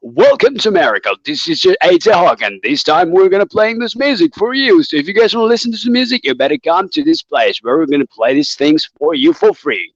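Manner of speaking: put-on American radio show voice; American radio host. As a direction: hype the music like a radio host. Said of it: "when they're talking radio show".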